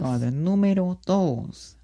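slow, drawn-out imitation of the YouTuber; Dross voice. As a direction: stretch "número dos" like Dross. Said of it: "Como Dross".

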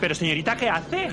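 high, loud indignant cry; shocked passenger protest; outraged surprise. Acted. Passenger protests phone taken.

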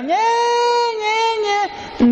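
put-on high wailing Ñe, Ñe from a baby; mock baby crying. Preacher imitating the baby crying on the plane, high wail. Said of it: "comienza la lloradera".

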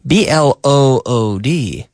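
letter by letter, slowly; spelled out. Shown as a demonstration. Teacher spells a word for Spanish learners, slowly letter by letter.

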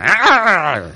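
mock startled exclamation; startled brush-off. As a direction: jump back at a stranger's remark. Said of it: "no le digan".

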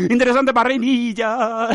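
high, loud, drawn-out catchphrase; hammed-up catchphrase. Podcaster repeating a silly catchphrase to listeners, hammed up.

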